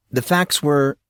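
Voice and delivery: ordinary delivery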